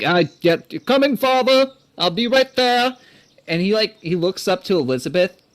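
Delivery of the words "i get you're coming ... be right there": put-on young dwarf son. Dwarf son answers his father, high and eager. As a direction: call back to father, pitched up. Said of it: "he's like".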